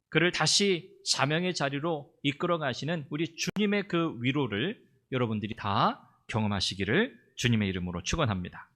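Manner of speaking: ordinary talk, nothing marked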